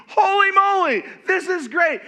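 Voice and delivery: loud high delight; excited. Real, not acted. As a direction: gush with delight. Celebrating the news.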